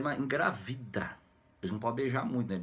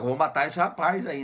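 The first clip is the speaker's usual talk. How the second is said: louder, higher burst of retold anger; mock angry threat; 5 semitones higher, 6 dB louder than usual. Jealous Irapuã threatens the guest, mock angry and louder. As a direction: act out a jealous rival's threat. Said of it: "O Irapuã fica nervoso".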